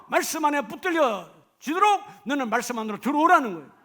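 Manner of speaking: loud, high exhortation; full-force preaching. Acted. Preacher exhorting the congregation to hear the word, loud and high. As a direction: thunder God's call to the hall.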